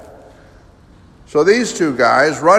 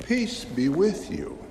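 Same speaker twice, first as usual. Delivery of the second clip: softer and slow, gentle; Jesus calmly greeting his disciples; 4 semitones higher, 7 dB quieter than usual. Acted. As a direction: speak peace to frightened friends.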